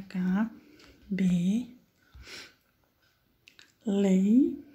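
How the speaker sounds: each syllable of Cabeleireiro said apart, with long pauses. Shown as a demonstration. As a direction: split Ca-be-lei-rei-ro into slow separate syllables. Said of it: "como que separa?". (separando Cabeleireiro sílaba por sílaba)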